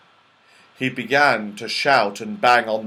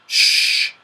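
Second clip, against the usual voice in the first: forceful voiceless hush; loud shush; 6 dB louder than usual. A narrator's reading. Everyone hushes Mother Bear, urgent shush. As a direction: shush the bears hard.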